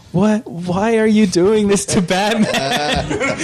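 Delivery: slow, high, laughing disbelief; hammed-up dismay, half laughing; mock outrage. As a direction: wail it like a betrayed kid. Kids outraged at the movie.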